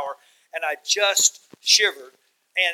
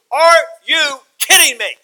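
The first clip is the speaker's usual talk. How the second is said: high, loud and slow, as if he can't believe it; preaching at full force, amazed; incredulous exclamation; 10 semitones higher, 11 dB louder, slower than usual. Acted. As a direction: shout your disbelief at Paul from the pulpit. Preacher marvels at Paul's shipwrecks.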